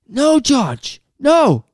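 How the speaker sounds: high, loud mock shriek at a child; acted parental yell. Parent yelling at George mid-cooking, high-pitched mock shout. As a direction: imitate a frantic telling-off from another room.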